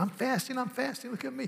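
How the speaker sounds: sing-song a show-off saint; mocking the hypocrite who brags of fasting. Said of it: "don't go around saying".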